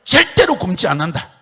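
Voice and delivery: high, very loud proclamation; preaching shout. Real, not acted. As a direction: belt it out from the pulpit. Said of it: "따라합시다".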